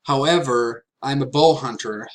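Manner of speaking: usual speaking voice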